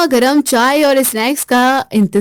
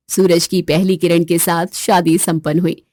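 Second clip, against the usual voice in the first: ordinary talk, nothing marked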